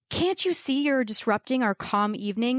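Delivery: ordinary talk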